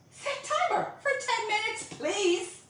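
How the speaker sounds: high, insistent command to a device; insistent please. Real, not acted. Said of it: "you have to say please".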